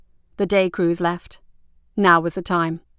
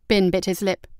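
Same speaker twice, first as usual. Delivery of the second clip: ordinary delivery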